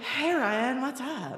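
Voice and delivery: trying hard to sound casual; feigned super cool. Retold or imitated. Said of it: "So I was super cool".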